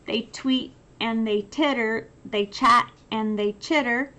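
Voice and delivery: lively picture-book read-aloud; reading to children. A narrator's reading. Teacher reading a picture book to children, lively. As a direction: read it like bedtime for little ones.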